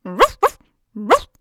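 loud, rising happy woofs; joyful barking. Acted. Nala shows off the found story, barking happily. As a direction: yap like a tail-wagging dog. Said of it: "wedelte freudig mit dem Schwanz und bellte".

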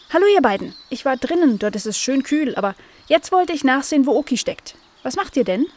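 high, lively little animal voice; chatty Cleo. Acted. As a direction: chatter brightly on arriving, pitched high. (hohe, muntere Plauderstimme)

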